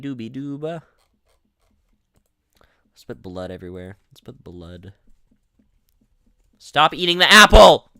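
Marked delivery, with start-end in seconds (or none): 2.9-4.9 whisper. Real, not acted. Mischievous play aside. 6.6-7.9 loud frustrated yell; yelling stop. Real, not acted. Gamer yelling at someone eating the apple, frustrated.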